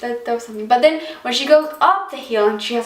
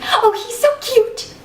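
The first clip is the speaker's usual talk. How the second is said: very high, drawn-out swoon; exaggerated squeal of adoration; showy crush; 11 semitones higher, 3 dB louder, slower than usual. Shown as a demonstration. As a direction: gush like a besotted fangirl. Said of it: "kinda, like, show it that".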